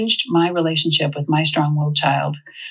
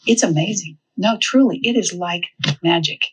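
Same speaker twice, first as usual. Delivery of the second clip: usual speaking voice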